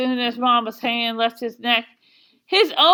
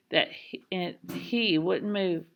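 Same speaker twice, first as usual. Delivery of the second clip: ordinary delivery, nothing marked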